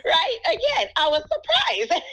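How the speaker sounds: high-pitched, laughing while talking. Real, not acted. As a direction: laugh in amazement through the words. Laughing over unexpected praise.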